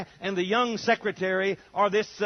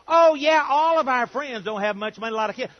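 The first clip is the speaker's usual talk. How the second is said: mock eager reply; 7 semitones higher, 10 dB louder than usual. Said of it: "I say to them".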